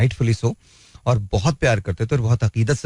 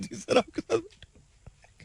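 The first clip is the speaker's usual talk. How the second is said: much higher, softer and slow, a nervous junior; meek timid reply; 9 dB quieter, slower than usual. Retold or imitated. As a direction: shrink into a small timid voice answering the boss. Meek reply to scolding senior.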